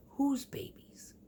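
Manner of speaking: slow whispered high query; whispered question. Retold or imitated. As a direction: hush it, puzzled. Sister's quoted reply.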